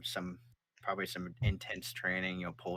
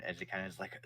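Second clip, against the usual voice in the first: ordinary talk, nothing marked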